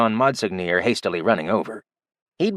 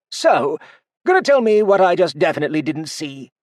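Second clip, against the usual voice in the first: lightly voiced dwarf, a touch higher and pointed; wary dwarf guard; 8 semitones higher, 4 dB louder than usual. A guard prodding Artorian, lightly voiced and wry. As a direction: press him for what you saw.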